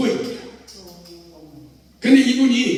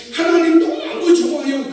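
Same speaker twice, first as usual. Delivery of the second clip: delighted cry; 7 semitones higher, 3 dB louder than usual. Retold or imitated. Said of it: "입당해가지고".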